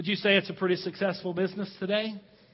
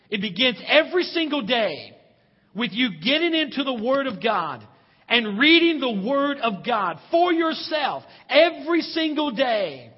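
louder and higher, slowing to a hammered end; rising preaching fervor; 5 semitones higher, 5 dB louder than usual. Real, not acted. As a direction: build to a pulpit climax. Preacher urging daily Bible reading.

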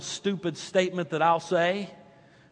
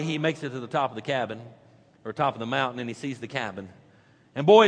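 ordinary talk, nothing marked

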